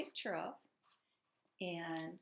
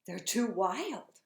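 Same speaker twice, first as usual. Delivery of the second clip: cried out; 7 dB louder, much slower than usual. Acted. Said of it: "cried Granddad".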